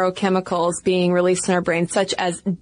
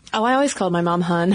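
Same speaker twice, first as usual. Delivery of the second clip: normal talking voice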